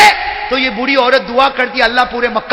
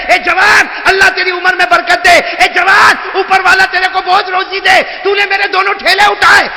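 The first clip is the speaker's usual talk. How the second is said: shouted, higher blessing called from behind; 7 semitones higher than usual. Acted. Old woman blesses him from behind. (پیچھے سے پکارتی بوڑھی عورت کی اونچی دعا)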